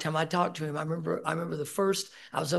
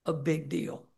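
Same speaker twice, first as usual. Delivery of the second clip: usual speaking voice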